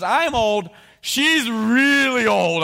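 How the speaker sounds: put-on high, loud, very slow stretched "really old"; mock Zechariah. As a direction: drag out "She's really old" for laughs. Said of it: "he goes".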